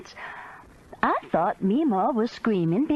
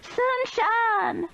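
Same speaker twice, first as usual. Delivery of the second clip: screamed name. A narrator's reading. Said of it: "Meemaw screamed".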